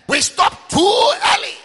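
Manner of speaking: slow, high, loud cry; preaching shout. Real, not acted. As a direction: stretch it out and lift it. Preacher's emphatic climax.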